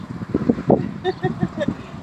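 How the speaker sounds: high unvoiced giggle; a delighted little laugh; breathy giggle. Real, not acted. Watching the leaf move, giggling with delight.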